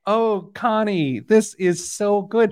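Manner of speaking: high gushing fake compliment; fake praise. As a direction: gush with exaggerated politeness. People praising mom's bad pizza.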